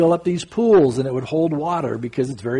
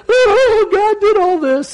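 very high, loud joyful cry; answering his friend in jubilant awe; ecstatic shout; 6 dB louder, slower than usual. Retold or imitated. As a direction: burst out in overwhelmed praise. Ecstatic reply out in surf.

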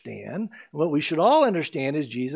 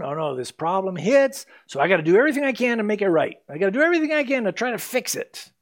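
frantic inner voice; 3 semitones higher, faster than usual. Retold or imitated. Inner voice of panicked self.

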